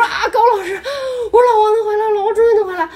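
high, loud, tearful cry of joy; shrieking with joy that Wang can return; overjoyed crying out. Retold or imitated. (高声喜极而泣地喊)

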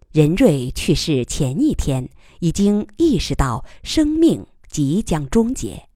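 ordinary delivery, nothing marked

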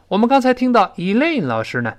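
normal talking voice

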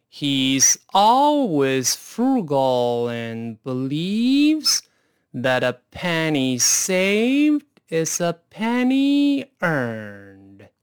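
very slow, drawn-out read with each word stretched; slowest speed. Shown as a demonstration. Teacher modelling the sentence for students at slowest speed. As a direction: spell out every word slowly for the learners. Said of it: "再来一遍慢速".

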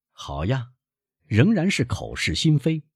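ordinary delivery

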